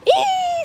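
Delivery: put-on short high squeal like a toddler; baby squeal. Aunt mimics niece's scream for stuff, high squeal. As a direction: squeak it out like a little kid screaming. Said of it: "screaming for stuff".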